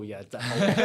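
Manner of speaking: loud laughing yeah definitely; laughing agreement. Retold or imitated. Mates joking about Johnheads, loud laughing. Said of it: "and you go".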